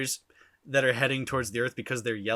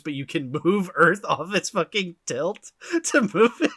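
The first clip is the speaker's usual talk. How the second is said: laughing; 6 semitones higher, 4 dB louder than usual. Real, not acted. Laughing at absurd power.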